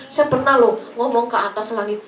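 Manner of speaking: ordinary delivery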